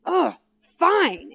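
high, exasperated groan into a grudging word; frustrated. Retold or imitated. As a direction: huff it out like a thwarted boyfriend. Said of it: "he gets all frustrated".

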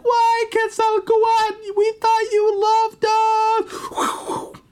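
very high, slow mock wail of betrayal; betrayed people crying out. People begging the wind god why, high, slow mock wail. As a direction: drag it out like crushed worshippers. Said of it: "Everyone's like".

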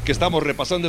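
ordinary delivery

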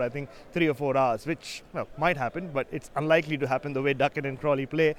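ordinary talk, nothing marked

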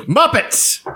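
high-pitched indignant correction; mock outrage. Co-host corrects someone who called Muppets puppets, mock-outraged. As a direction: act hugely offended, voice shooting up.

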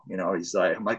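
normal talking voice

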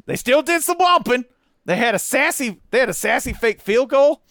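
high, loud, sing-song praise, stress on 'sassy'; gushing. Real, not acted. Fan gushing over Arkansas's trick play, high and excited. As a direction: delight bubbling over.

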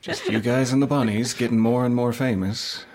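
normal talking voice

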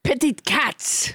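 mock French accent; French Trump. French Trump insists on petted cats to co-host, French accent. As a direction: repeat it in a comic French accent. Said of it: "a French translation for petty fours".

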